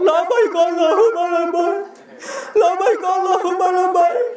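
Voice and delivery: high, wailing pilgrim chant; mock tearful talbiyah. Pilgrim answering his guide at the Kaaba, mock crying chant. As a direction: imitate an old pilgrim sobbing out the response. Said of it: "Bapak nangis".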